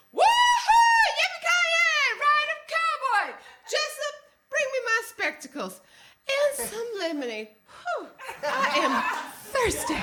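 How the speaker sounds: unleash an over-the-top Southern holler; Southern belle. Acted. Second night defying the director, high Southern belle holler.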